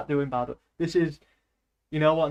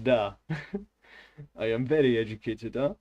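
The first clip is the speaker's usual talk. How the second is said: put-on hammy Russian accent; Russian-accented English with a chuckle; educated Russian; 3 semitones lower than usual. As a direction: play a learned Russian, thick accent. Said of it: "I'm a very educated Russian".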